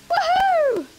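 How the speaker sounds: very high whooping cheer; excited whoop. Real, not acted. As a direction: whoop with pure joy. Celebrating the win.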